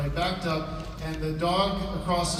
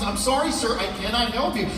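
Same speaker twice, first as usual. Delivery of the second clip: stiff vet; 5 semitones higher, 3 dB louder than usual. Retold or imitated. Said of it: "He says".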